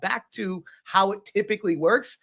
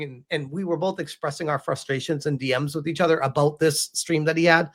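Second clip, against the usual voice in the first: ordinary talk, nothing marked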